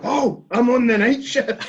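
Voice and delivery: high, slow moaning neighbour voice; grumpy neighbour. Retold or imitated. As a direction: whine it from an upstairs window. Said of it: "somebody pops up going".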